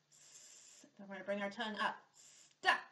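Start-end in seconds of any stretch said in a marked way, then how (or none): 0.1-0.8 a hissing s sound, unvoiced; snake sound. Shown as a demonstration. Teacher shows children the snake sound, hissing. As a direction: hold a soft snake hiss. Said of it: "our snake sound".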